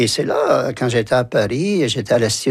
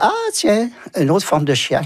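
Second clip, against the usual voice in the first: a bright, much higher "Ah, tiens" of mock discovery; amused surprise; 9 semitones higher than usual. Student in Paris hearing Maghrebi classmates, mock surprise. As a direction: act out spotting something familiar, lightly amused. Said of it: "Alors, j'ai dit".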